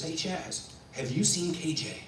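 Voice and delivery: soft stage manager's voice; hushed backstage query. Retold or imitated. Stage manager to young actor backstage, hushed. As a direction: keep it down in the wings.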